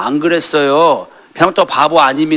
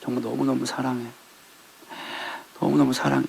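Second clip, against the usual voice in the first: tender repetition; 10 dB quieter than usual. Retold or imitated. Husband repeating love.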